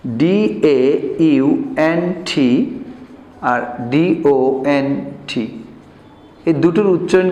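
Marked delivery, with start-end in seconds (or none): 0.1-2.8 ডিএইউএনটি spelled letter by letter, slowly; slow spelling of ডিএইউএনটি for students. Shown as a demonstration. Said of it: "আমি একটু এখানে শিখাই". 3.4-5.6 ডিওএনটি spelled letter by letter, slowly; slow spelling of ডিওএনটি. Shown as a demonstration. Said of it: "আমি একটু এখানে শিখাই".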